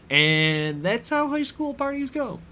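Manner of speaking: playful overblown summary; mock grand statement. Shown as a demonstration. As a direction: deliver it like a sweeping verdict, hammed up. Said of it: "No, that, that's just kinda how".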